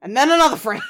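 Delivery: playful shout; loud joking. Real, not acted. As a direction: punch it out loud for the joke. Joking about the single frame.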